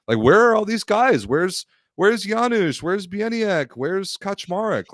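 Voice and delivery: high, whiny mock fan voice; mocking clueless fan. Mocking clueless fans' comments.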